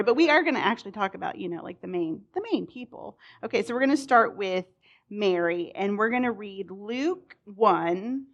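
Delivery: ordinary talk, nothing marked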